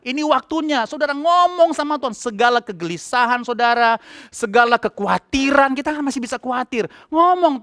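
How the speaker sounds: high-pitched fervent preaching. Acted. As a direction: urge the congregation with full conviction. Preaching at full force. (khotbah berapi-api dengan nada tinggi)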